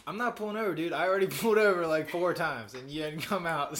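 high, loud mock exasperation at the chipmunk; mock scolding. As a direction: lay down the law to the critter. Scolding chipmunk in retold scene.

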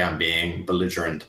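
ordinary delivery

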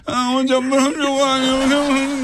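high, slow whining complaint; whiny patient voice. Retold or imitated. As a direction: moan like a suffering patient. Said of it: "diye giriyor yani".

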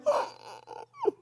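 sharp high startled gasp; imitated high gasp. Wife spotting the girl at the edge, a high gasp. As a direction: gasp in alarm. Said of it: "And Lauren's like".

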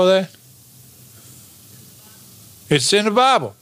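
high-pitched, rising preacher fervour; impassioned preaching. Real, not acted. Preacher urging congregation to grow in knowledge of Christ, impassioned. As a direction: preach it with mounting urgency, pitched well up.

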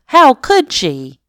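high, hurt outcry; betrayed. Acted. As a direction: cry out in betrayal. Cat feels betrayed.

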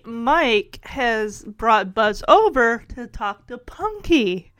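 normal talking voice

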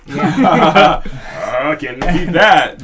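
laughing loudly, words drawn out slow. Real, not acted. Veteran laughing to friends about unbeatable perks, loud laugh. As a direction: laugh it out big and high.

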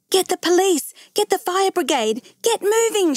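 high, urgent yelled commands; narrator's high yell, little added volume; yelling orders. A narrator's reading. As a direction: send people running with high-pitched orders. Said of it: "she yelled".

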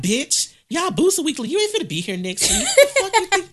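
high, loud mock tirade; imitating mama cussing. Mama telling off the shoe seller, loud and high. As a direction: let mama's cussing rip high and loud. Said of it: "she cussed out".

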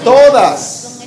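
high, loud single-word proclamation; loud emphatic. Real, not acted. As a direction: ring it out to the congregation. Preacher's climactic one-word answer.

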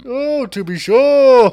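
loud, high stage Irish, drawn out; Irish accent. Acted. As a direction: sing out a hammed-up Irish accent. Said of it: "That's your Irish accent".

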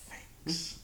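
whispered, sarcastic thanks; a sour whispered thanks; grudging whisper. Retold or imitated. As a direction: breathe it out grudgingly.

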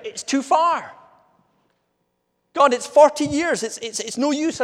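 high, loud, hammed-up complaint; grumbling and moaning. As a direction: whinge at God like a fed-up Israelite. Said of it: "they just kept moaning, moaning and moaning".

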